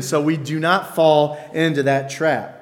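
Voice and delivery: ordinary delivery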